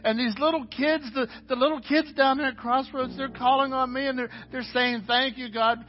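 high, urgent preacher's voice; impassioned preaching. Acted. Pastor tells the congregation God hears them, pitched high and fervent. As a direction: preach it, lifted and pressing.

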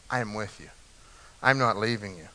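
near-hushed repeat. Real, not acted. God's promise quoted, then echoed.

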